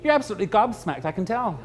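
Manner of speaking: usual speaking voice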